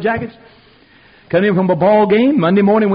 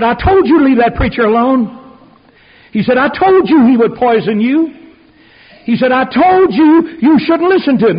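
louder, higher retold scolding, the last line highest; scolding boss; 6 semitones higher, 7 dB louder than usual. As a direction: hammer home each I told you like an angry boss. Angry boss scolds him.

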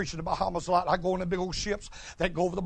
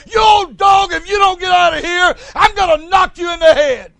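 high, loud, fast hollering; excited shout; 8 semitones higher, 12 dB louder than usual. Retold or imitated. Mother showing her daughter how to yell the play line, loud. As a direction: throw real excitement into the broom line. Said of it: "Put some excitement in it".

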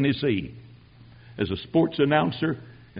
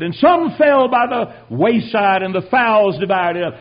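high, loud and slow scripture reading; the pastor preaching to his congregation; slow pulpit proclamation; 5 semitones higher, 7 dB louder, slower than usual. Retold or imitated. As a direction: draw it out like a preacher holding the crowd.

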